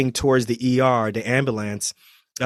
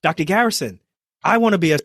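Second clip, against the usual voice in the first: put-on eager teen excitement; 7 semitones higher than usual. Teen begging a trauma surgeon to shadow him, eager and pitched up. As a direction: blurt it out like a starstruck seventeen-year-old. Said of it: "I was like".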